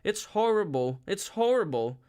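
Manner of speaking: high, loud tearful repeating; crying. A narrator's reading. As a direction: near tears, pleading. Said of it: "But Lenina was crying".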